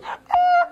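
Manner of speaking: a high squeal of brakes as the car swerves; imitated tire screech. Car swerving for John, imitated high screech. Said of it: "this car coming the opposite way goes".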